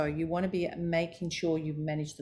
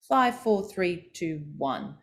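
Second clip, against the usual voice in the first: descending pitch; slower than usual. Shown as a demonstration. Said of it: "going down the stairs".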